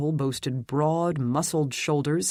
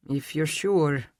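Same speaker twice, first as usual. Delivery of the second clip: doubtful. A narrator's reading. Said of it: "he said doubtfully".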